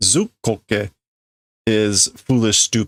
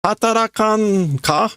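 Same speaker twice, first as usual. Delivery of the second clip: high-pitched scolding voice; scolding boss; 11 semitones higher than usual. Acted. Boss scolding idle workers, high-pitched scolding.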